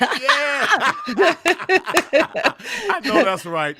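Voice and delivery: loud laugh through the words; laughing agreement, slow and loud. Real, not acted. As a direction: crack up at the joke. Laughing at OG joke.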